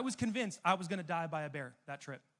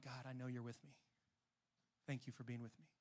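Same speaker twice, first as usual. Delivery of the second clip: soft, hushed thanks to God; quiet prayer; 5 semitones lower, 12 dB quieter than usual. Shown as a demonstration. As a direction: murmur it gently, as a private prayer. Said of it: "remember, like".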